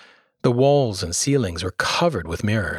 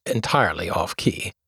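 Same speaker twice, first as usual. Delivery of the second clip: ordinary delivery, nothing marked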